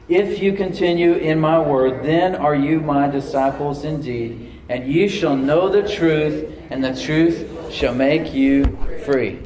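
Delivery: slow, loud scripture reading aloud; slow congregational reading. Shown as a demonstration. Pastor leading congregation in reading verse, slow and loud. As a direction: lead the church reading the verse slowly. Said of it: "let's read it out loud".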